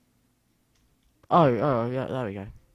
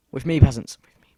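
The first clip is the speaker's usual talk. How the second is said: commanding call; 8 dB louder than usual. Pretend. Commanding summoned peasants.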